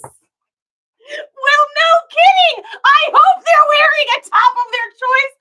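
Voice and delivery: high, loud sarcastic words through laugh-crying; mock your own silly line while cracking up; laughing sarcasm. Real, not acted. Mocking her own old description.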